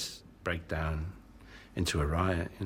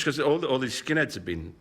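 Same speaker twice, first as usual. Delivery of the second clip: ordinary talk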